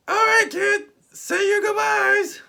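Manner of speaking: very high, very loud put-on voice; Doctor Strange. Recap host as wizard ordering goodbyes, high slow shout. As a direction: shout it high, stretching the last words. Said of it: "And Doctor Strange's like".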